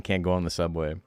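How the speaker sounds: ordinary talk, nothing marked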